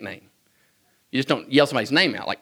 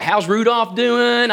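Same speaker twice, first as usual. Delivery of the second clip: put-on higher, slow curious child voice; eager kid; 7 semitones higher, much slower than usual. Boy asking Santa about Rudolph, slow wide-eyed child voice. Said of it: "And I was like".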